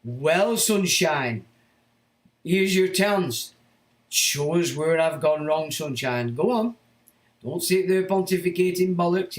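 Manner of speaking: real anger at the critic; angry challenge. Real, not acted. Challenging a hostile chat commenter.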